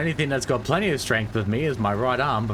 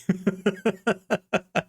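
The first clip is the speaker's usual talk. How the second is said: giggle; 7 semitones higher than usual. Real, not acted. Laughs after sour sip.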